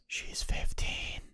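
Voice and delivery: slow, hushed whisper; whispered warning. Retold or imitated. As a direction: whisper it slowly like a secret warning. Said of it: "leans over and goes".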